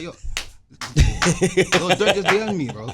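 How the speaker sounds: laughing, slow and loud with excitement; excited. Retold or imitated. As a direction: gush it out, laughing. Excitedly telling mom about DM.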